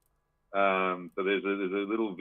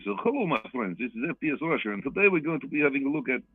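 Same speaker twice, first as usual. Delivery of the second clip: put-on Russian accent, pitched up; FPS Russia; 6 semitones higher, faster than usual. Imitating a YouTuber greeting his friends, mock Russian accent. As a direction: mimic a video host's greeting in a Russian accent. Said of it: "he does the fucking Russian accent so fucking well".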